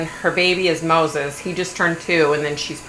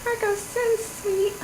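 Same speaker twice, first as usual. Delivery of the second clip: much higher, far-off mock swoon; mock swooning wife; 5 dB quieter, slower than usual. Imitating the wife cooing at her husband, much higher mock swoon. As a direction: swoon over Marco in a squeaky fake voice. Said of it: "And she's always like".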